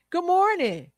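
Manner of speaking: high, sing-out morning-person hello; perky greeting. Acted. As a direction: greet like an eager early riser. Acts out morning person's chirpy greeting.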